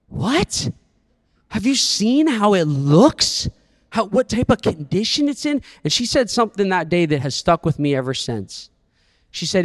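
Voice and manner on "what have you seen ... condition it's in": put-on disbelieving kid.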